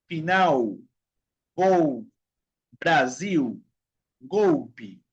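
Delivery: final, gol, Brasil, golpe as "finau, gou, Braziu, goupi"; each of final, gol, Brasil, golpe apart, L rounded to u. Shown as a demonstration. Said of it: "se pronuncia la L como la U".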